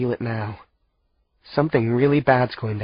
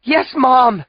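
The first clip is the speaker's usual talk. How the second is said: high, loud eager call; 8 dB louder than usual. A narrator's reading. Daughter answering her mother, loud call. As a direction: shout back into the dark.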